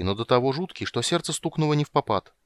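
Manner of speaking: usual speaking voice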